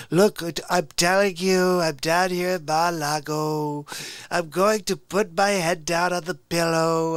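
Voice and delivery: self-important, dragging caricature voice; Donald Trump. Acted. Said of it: "Donald Trump impression".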